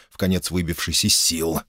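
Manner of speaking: plain reading voice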